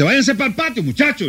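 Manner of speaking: put-on nervous mother shooing the kids to the patio. Mother shoos kids again.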